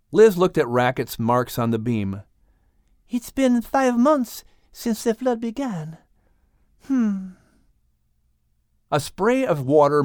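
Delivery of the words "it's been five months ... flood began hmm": put-on high French-accented voice, calmly asking; high, poised French accent. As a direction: play Liz with a French accent, asking the whale. Liz French voice.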